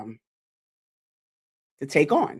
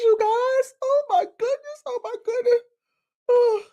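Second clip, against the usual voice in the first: crying. Real, not acted. Overwhelmed by own work montage.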